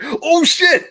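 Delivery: high-pitched re-enacted shock; retold astonishment. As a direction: act out a sudden startled discovery. Retells own startled recognition of voice.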